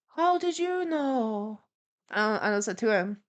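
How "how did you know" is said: slow, high-pitched mock awe; imitated amazement. Flatmate astonished someone knows about his dirty laundry, imitated amazement. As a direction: draw every word out in dopey wonder.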